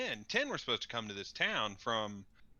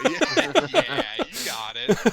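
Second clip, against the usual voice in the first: much higher, louder laughing "yeah"; cracking up at the joke; laughing agreement; 12 semitones higher, 12 dB louder than usual. Real, not acted. Player laughing at the GM's joke about assholes.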